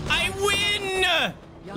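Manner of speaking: high, drawn-out victory yell; triumphant cry. Real, not acted. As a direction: stretch it out in triumph. Celebrates killing the boss.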